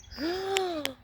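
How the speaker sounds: a high, sharp gasp of shock; a real shocked gasp. Real, not acted. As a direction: gasp at the ruined flowers. Discovers dirt on her flowers.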